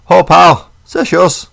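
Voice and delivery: high, loud Glaswegian call; Glaswegian accent. Retold or imitated. Speaker shows how local dialect slipped out, Glaswegian accent. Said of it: "the local dialect".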